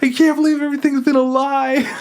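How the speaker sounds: high, panicked squeal; panicking. Retold or imitated. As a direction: cry out in comic despair. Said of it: "She's just panicking".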